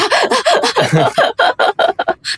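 high bursting laugh at her own joke; burst of laughter. Real, not acted. Host laughing at her own quip about China.

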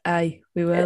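put-on light Scottish accent, jokey. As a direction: answer in a playful Scottish accent. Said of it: "in Scottish accent".

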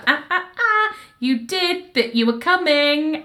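high playful tut-tut, lightly voiced; mock-scolding sing-song; teasing correction. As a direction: wag a finger with the voice. [name] teasingly corrects Bill.

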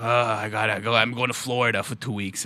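lightly voiced weary roommate; weary groan. Friend imitating a classmate leaving for Florida, mild weary groan.

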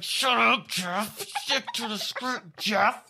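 high, slow, put-on angry nurse; pissed nurse. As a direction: bite off each word at Jeff. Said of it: "She was like".

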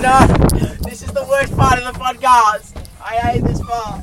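high, loud mock-anguished yelling; loud protest. Real, not acted. Friend yelling on the roundabout, hammed-up loud protest. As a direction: yell out the protest over the ride.

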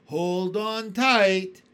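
slow, loud warning holler; excited shout. A narrator's reading. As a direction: bellow the warning. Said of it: "shouted the old green grasshopper".

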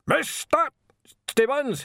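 loud, high roar, bouncing off walls; bellow. A narrator's reading. Arch-Chancellor summons Stibbons from the bath, bellowing. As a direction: bellow the name imperiously. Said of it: "he bellowed".

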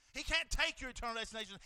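normal talking voice

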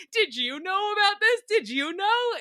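a touch of a dramatic friend fishing for gossip; imitating Danielle. Mimicking Danielle questioning Lindsay's family, high mock-frantic. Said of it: "being like".

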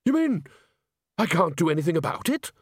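high, incredulous question; dismayed. Acted. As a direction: disbelief in the voice. Ghost dismayed.

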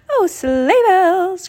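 high, slow, stretched-out oh; dismayed cry. Acted. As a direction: wail the mild curse. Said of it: "cried Shimmer".

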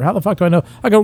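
normal talking voice

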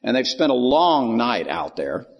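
ordinary talk

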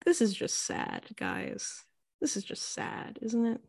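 hushed, dropping almost to a whisper; quiet dismay. Acted. Music watching the fake girlfriend display, quiet dismay. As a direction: murmur it as a pitying aside.

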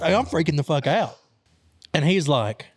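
ordinary talk

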